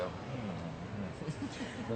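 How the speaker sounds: low quiet uneasy hmm; uneasy hum. Retold or imitated. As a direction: hum low and soft, unsettled. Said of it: "where you go".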